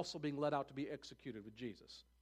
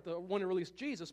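ordinary delivery

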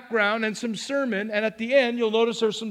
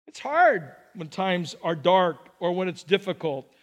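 usual speaking voice

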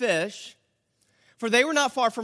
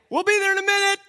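casual; 10 semitones higher, 5 dB louder, faster than usual. Pretend. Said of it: "he didn't say".

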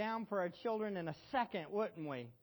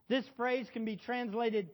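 ordinary talk

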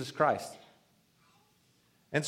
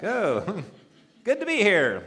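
mock cheery greeter; 6 semitones higher, 5 dB louder, slower than usual. Mocking an overbright greeter.

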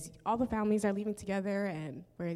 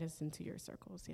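whispering; 11 dB quieter than usual. Real, not acted. Repeats plea, drops to whisper.

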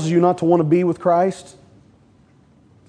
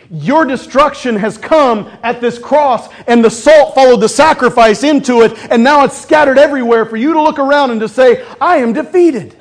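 building sermon; 7 semitones higher, 6 dB louder than usual. Acted.

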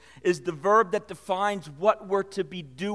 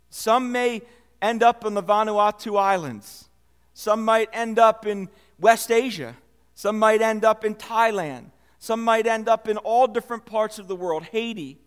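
louder preaching, each Some might end up hit hard; rousing preaching; 5 dB louder than usual. Acted. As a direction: build the list like a call to mission. Preacher building to a rousing list.

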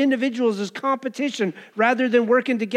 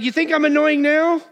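high, raised mock challenge; defiant beggar; 7 semitones higher, 4 dB louder than usual. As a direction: taunt the scolding crowd, pitched up. Acts beggar defying the crowd.

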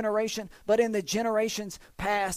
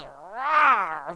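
one long growling roar; growled roar. Acted. Preacher imitating God roaring from Zion, growling roar. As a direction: let the word become a beast's roar. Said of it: "He just says".

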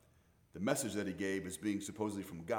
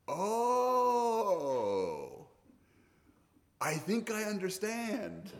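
high, drawn-out mock realisation; dawning realisation; 10 semitones higher, much slower than usual. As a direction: stretch it out as understanding finally dawns, high. Acts out host finally getting it.

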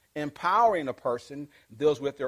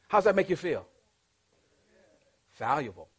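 normal talking voice

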